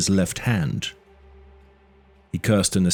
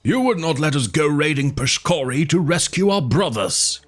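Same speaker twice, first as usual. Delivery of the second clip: higher accusing shout; 7 semitones higher than usual. A narrator's reading. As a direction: shout the charge at him. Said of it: "shouted accusingly".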